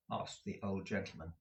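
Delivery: plain reading voice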